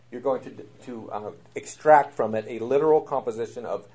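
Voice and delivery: ordinary delivery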